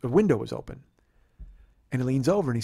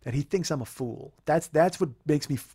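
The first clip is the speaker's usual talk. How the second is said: ordinary talk, nothing marked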